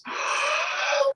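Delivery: exaggerated drawn-in breath; big horn-blowing inhale. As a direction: suck in air to blow a horn. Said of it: "raises his sounding horn to his lips and goes".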